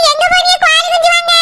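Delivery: normal talking voice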